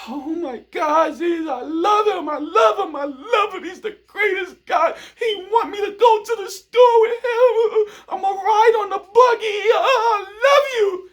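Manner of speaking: very high shrieking joy that breaks into laughing and crying; overjoyed falsetto shouting, laughing and sobbing at once; gushing girlfriend's inner voice. Retold or imitated. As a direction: squeal like she can't contain her love. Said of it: "But inside, they're like".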